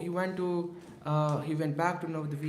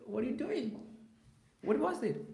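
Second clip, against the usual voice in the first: shocked question; 9 semitones higher than usual. Retold or imitated. Mahaprabhu shocked by Srinivas.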